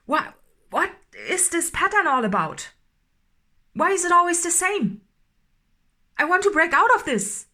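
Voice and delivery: getting angry. Acted. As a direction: burst out, desperate to break free. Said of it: "Oh, I'm getting so angry now".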